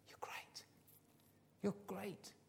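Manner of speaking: hushed, nearly whispered praise; softly, as a proud father; tender affirmation. Acted. Dads to their sons and daughters, hushed and tender. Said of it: "Isn't that powerful for dads to say".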